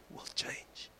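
breathy whisper for the punchline; whispered emphasis. Acted. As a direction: drop to a hush, no voice. Whispered climax of point.

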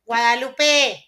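long called-out name. Acted. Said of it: "No, hazlo bonito".

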